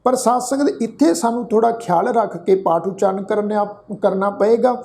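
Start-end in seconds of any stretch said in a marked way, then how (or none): none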